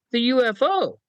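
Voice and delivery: high, deadpan answer as if it were obvious; matter-of-fact caller. Retold or imitated. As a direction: state it like the most normal thing. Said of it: "She goes".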